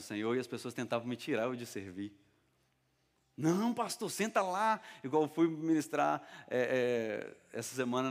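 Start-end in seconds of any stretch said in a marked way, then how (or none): 3.3-4.8 high, drawn-out friendly protest; high-pitched polite insistence; insistent protest. Retold or imitated. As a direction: imitate someone urging the pastor to sit, pitched up. Church member urging pastor to sit.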